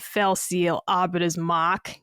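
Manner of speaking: Boston accent, "Arbiter's Mark" as "Ahbitah's Mahk", r dropped; slow Boston accent dropping the r in "Mark". Shown as a demonstration. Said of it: "saying it with a Boston accent".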